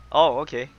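ordinary delivery

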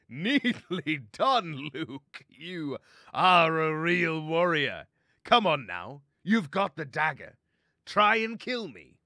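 high, loud, slow mock applause; pirate captain loudly goading a foe; taunting pirate stoat. Daskar applauds his captive and dares him to attack, loud and taunting.

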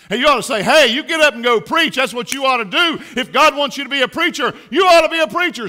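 high, loud exhortation; loud preaching. Real, not acted. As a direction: urge it hard, like a father's charge. Tells parents what to say.

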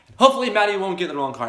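teasing outburst. Real, not acted. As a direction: blurt the tease out loud and quick. Teasing friend, loud and fast.

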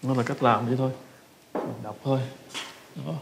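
usual speaking voice

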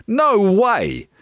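put-on high-pitched boyish protest; groaning boy. A narrator's reading. Jack refuses the giant's slime, high groaning protest. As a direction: refuse like a disgusted child. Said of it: "groaned Jack".

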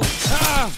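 high, loud "ah" yelp; sung yelp. Pretend. Gamer punctuating the pop chorus, loud high yelp.

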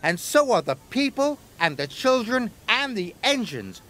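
lightly voiced eager Percy; earnest little engine. A narrator's reading. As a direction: insist everyone matters. Percy defends Sodor.

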